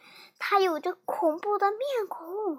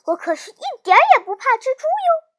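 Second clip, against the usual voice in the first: higher, louder, quick bragging; proud boast; 7 semitones higher, 6 dB louder, faster than usual. A narrator's reading. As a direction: boast in a raised character voice. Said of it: "苏丹骄傲地说".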